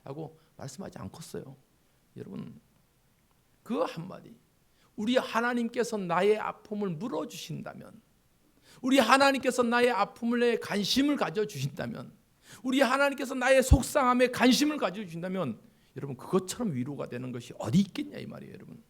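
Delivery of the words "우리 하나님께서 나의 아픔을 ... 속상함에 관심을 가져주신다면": each line higher and louder; building preaching. Real, not acted. Preacher building up the congregation, rising higher and louder. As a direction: climb with every repetition.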